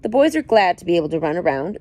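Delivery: ordinary delivery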